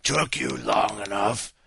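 low groaning undead voice; zombie groan. A narrator's reading. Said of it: "groaned the zombie".

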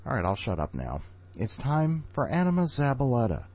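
ordinary talk

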